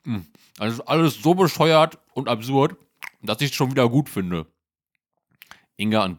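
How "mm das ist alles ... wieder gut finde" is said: mouth full. Acted. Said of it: "Klaus sagte mit vollem Mund".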